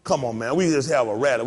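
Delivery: ordinary talk, nothing marked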